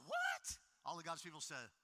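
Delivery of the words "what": much higher, shocked what; disbelieving what. Retold or imitated. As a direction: squeak it out in disbelief. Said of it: "I'm like".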